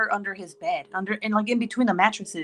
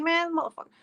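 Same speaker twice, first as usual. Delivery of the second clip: higher, mock-exasperated swear; exasperated curse; 10 semitones higher, faster than usual. Mom catching her sneaky son, mock exasperation. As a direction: huff it out at the sneaky kid. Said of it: "I was like".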